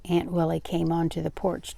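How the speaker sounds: usual speaking voice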